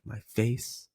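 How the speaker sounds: ordinary talk, nothing marked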